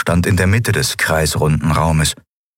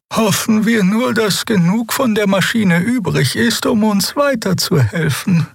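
1 hohe Figurenstimme. Acted. Xea spricht, fremde Figurenstimme.

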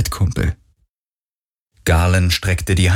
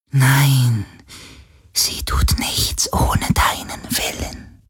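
ghostly whisper; 4 semitones higher than usual. Acted.

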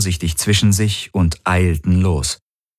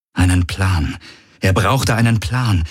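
usual speaking voice

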